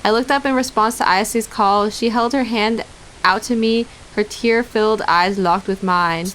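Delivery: ordinary talk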